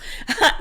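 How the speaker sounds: quick laugh at herself; a sheepish little laugh; short embarrassed laugh. Real, not acted. Laughs at own breathlessness.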